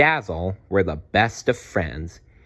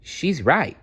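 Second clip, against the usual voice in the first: put-on young dino; 8 semitones higher than usual. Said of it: "said Dazzle".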